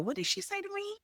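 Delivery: high, soft mock-baffled question; light, high-pitched double take at what was heard; puzzled reaction. Listener replaying a recording to catch it, puzzled.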